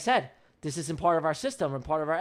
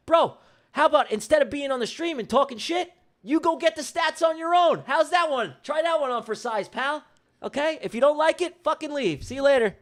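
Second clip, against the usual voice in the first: raised, high-pitched angry retort; getting mad; 8 semitones higher than usual. Real, not acted. Streamer sending Russell off the stream, getting mad. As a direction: snap back at the heckler, voice riding high. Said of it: "I'm getting mad".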